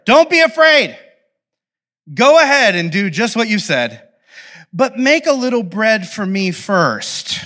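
high, loud opening, easing slightly; overblown proclamation. Acted. Prophet reassuring the despairing widow, overblown proclamation. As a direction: proclaim it with tactless gusto. Prophet's speech read with hammed-up force.

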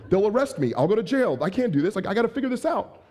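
acted panic. New dad realizing the baby depends on him, acted panic. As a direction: play a new parent spiraling into panic. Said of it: "you're panicking and you're free".

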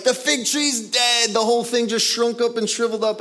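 high-pitched, astonished report; hammed-up amazement, pitched high; amazed disciple. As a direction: blurt it out in acted disbelief. Acts out Peter's amazed report.

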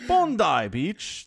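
Bondi Beach drawn out slowly, BON-dye; slow careful Bondi. Shown as a demonstration. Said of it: "That also sounds Aussie".

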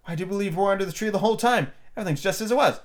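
high, quick amazement; astonished young Alice; great surprise. A narrator's reading. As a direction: marvel in a high, hurried voice. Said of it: "Alice looked around her in great surprise".

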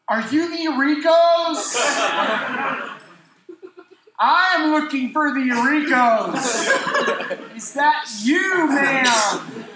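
confused polite pleading; laughing flustered question; flustered buddy. Retold or imitated. Buddy confused, voiced in story.

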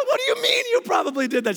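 rapid squeaky disbelief; indignant. Retold or imitated. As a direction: demand she explain herself. Indignant quote to wife.

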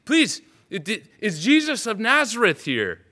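high, desperate begging; urgent pleading. Acted. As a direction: tug at strangers, begging for an answer. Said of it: "reaching out ... and saying".

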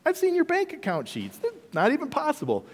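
high, scornful banker laughing at you; mocking banker. Bank manager laughing at a broke borrower's face, scornfully high. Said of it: "laughing at you to your face".

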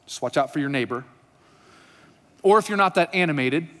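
normal talking voice

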